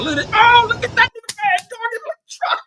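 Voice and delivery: high, squealing laugh-talk; laughing disbelief. Real, not acted. Vlogger cracking up at Trump's footage, high laughing. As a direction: crack up watching the clip.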